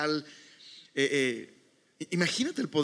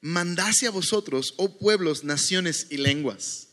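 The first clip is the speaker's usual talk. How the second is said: ordinary talk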